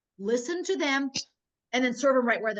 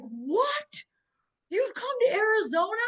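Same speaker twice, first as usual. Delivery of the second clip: high, startled what, rising in disbelief; pitched-up delighted disbelief; surprised; 9 semitones higher, slower than usual. Retold or imitated. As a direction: act out a friend caught off guard. Friend surprised by visit.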